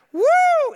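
high, loud whoop; crowd cheer. Retold or imitated. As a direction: whoop with holiday excitement. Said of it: "It's like".

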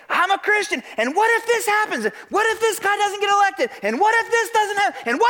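high-pitched mock hysteria; hammed-up panic, worries piling up in a high voice; freaking out. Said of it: "They're freaking out".

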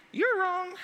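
suddenly high-pitched verdict; teasing high voice. Acted. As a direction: pitch it way up, playfully judging. Teasing verdict on pizza dissenters.

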